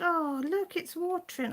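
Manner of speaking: worried mum cooing at her cat's sore eye. Retold or imitated. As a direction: coo over the hurt cat like a worried mum. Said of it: "I said".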